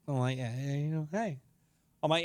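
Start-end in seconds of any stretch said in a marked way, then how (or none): 0.4-1.4 soft, low mock-bashful acknowledgement; acted bashful murmur; sheepishly pleased. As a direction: quietly concede it, a little pleased. Sheepishly pleased after a kiss.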